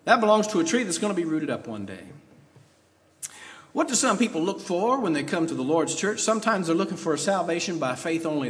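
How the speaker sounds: normal talking voice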